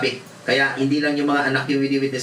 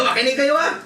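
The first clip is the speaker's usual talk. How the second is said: raised high command; stern order; 11 semitones higher than usual. Retold or imitated. Pastor ordering students to listen, high and firm. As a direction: demand their attention.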